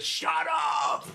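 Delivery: high, full-out shout; sudden outburst. Acted. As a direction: shout it to silence the voices. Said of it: "and he says".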